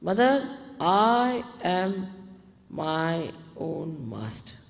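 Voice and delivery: slow, deliberate affirmation; full confidence. Shown as a demonstration. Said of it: "Say it with full confidence".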